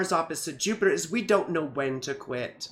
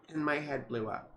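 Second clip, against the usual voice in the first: ordinary delivery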